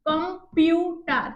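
"Computer" slow, as কম, পিউ, টার; three beats. Shown as a demonstration. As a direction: give "computer" three separate syllables. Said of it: "তিনবার উচ্চারণ করেছি". ("Computer" তিন ঝোঁকে)

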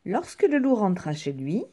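ordinary delivery, nothing marked

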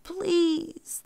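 high, drawn-out plea; pleading. A narrator's reading. Brahmin begs the hungry tiger, pleading. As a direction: beg without raising the volume. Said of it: "the Brahmin pleaded".

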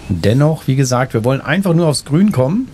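ordinary delivery

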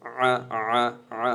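আ squeezed from the throat; throat pressed. Shown as a demonstration. Teacher drilling the letter ain, throat-squeezed sound. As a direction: press the throat on each short আ. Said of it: "আইন তো গলায় চাপ দিবে".

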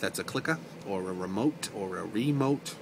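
"remote" first with a short ri, then with a long ree; two remote versions. Shown as a demonstration. Showing how she varies remote, two ways. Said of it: "depending on how I'm feeling".

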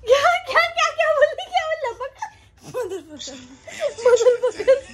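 high, laughing disbelief; shocked. Real, not acted. Sister reacting to a curse word, high laughing shock. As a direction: act scandalised and laughing.

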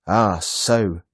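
loud, indignant exclamation; crying out. A narrator's reading. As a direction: cry out as if catching the culprit. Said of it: "Santa Claus cries".

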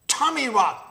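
high, loud indignant snap; jackal snapping. Acted. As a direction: vain cub snaps back at his teasing sisters. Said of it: "the jackal snapped".

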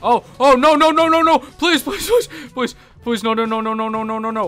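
high, loud frantic begging; panicked pleading. Real, not acted. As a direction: beg desperately.

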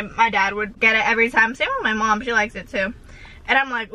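ordinary delivery

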